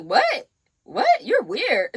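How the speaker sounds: high, loud incredulous "what"; retold bafflement. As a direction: act out being weirded out. Retells baffled reply to flirting.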